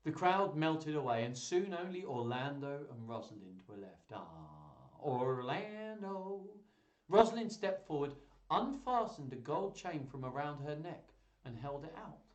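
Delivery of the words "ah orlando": long drawn-out, rising name; hammed-up lovestruck sigh; mock swoon. Swooning over Orlando.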